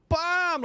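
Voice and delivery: high, punched "bam"; explosive impact noise. Pretend. Streamer showing off his golf swing, high punchy impact noise. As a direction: voice the crack of a big hit.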